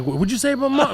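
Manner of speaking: high, startled outraged voice; outraged reaction. Retold or imitated. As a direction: bristle at an insult to mom.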